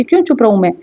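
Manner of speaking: ordinary talk, nothing marked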